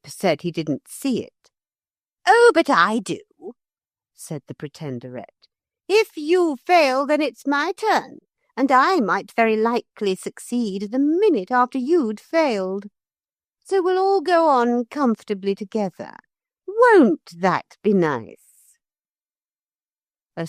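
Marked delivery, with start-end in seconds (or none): 2.2-3.2 higher, louder sly retort; a smug villainess answering back; Pretenderette voice. A narrator's reading. Pretenderette smugly contradicting Philip. 5.9-18.4 higher, sly scheming lady; Pretenderette voice. A narrator's reading. Pretenderette sweetly mocking the children's plan.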